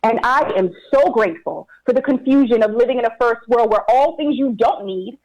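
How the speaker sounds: ordinary talk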